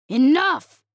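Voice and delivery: loud, spat-out shout. A narrator's reading. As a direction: spit the word in pain and rage. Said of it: "Zarthok spat".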